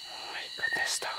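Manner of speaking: soft frightened whisper; hushed alarm; scared whisper. Real, not acted. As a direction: whisper so nothing hears. Hearing something, whispering.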